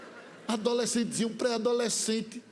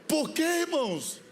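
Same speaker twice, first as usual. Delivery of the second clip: higher, sing-song sermon delivery; preaching cadence; 3 semitones higher than usual. Acted. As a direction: preach it with a chanting lilt. Preaching to congregation. (pregação cantada)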